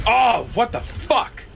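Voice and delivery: drawn-out high startled cry; shocked. Real, not acted. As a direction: caught totally off guard. Sudden shock in firefight.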